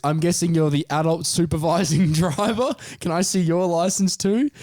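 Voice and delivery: high, laughing mock cop; amused cop. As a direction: tease the kids through a laugh. Cop amused at young drivers.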